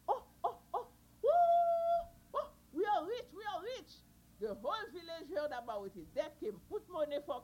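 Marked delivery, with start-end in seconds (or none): 0.1-3.9 high, loud joyful cry; delighted whoop. Acted. Kanzo realizes the singing turtle makes him rich, joyful high whoop. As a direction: whoop for the fortune coming.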